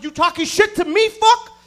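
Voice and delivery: loud, rising confrontational yell; angry challenge. Retold or imitated. Comic to trash-talking roommate, loud high challenge. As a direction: square up loudly, pitch climbing high.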